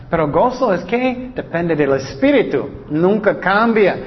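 ordinary delivery

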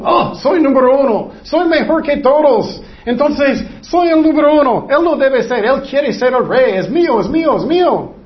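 high, insistent bragging voice; boastful, jealous king. Acted. Preacher acts out jealous Saul claiming the kingship, boastful and insistent. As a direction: rant like a self-important rival claiming the throne.

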